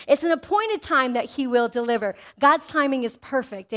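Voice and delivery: normal talking voice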